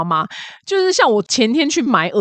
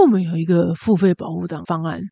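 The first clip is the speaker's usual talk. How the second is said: lightly voiced jaded clerk, lower; world-weary clerk; 3 semitones lower than usual. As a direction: sell it half-heartedly, like a bored clerk. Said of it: "他说".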